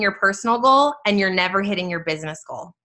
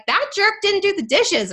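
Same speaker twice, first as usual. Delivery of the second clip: higher-pitched mock complaint; annoyed wife; 10 semitones higher than usual. Wife griping about her husband a week after date night, mock-annoyed. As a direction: gripe in a raised, put-upon voice.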